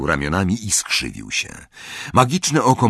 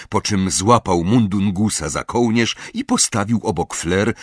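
ordinary delivery

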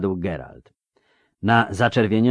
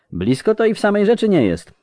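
high-pitched, louder character voice; rustic steward; 14 semitones higher, 5 dB louder than usual. A narrator's reading. Fierabras answering Geralt's sour remark, folksy character voice. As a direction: play a talkative provincial steward conceding a point.

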